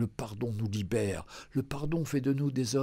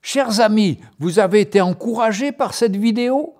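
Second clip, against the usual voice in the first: loud, upbeat ad pitch; promo read; 5 semitones higher, 12 dB louder than usual. Acted. Storyteller promoting books to viewers, loud ad read. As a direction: sell the books with energy.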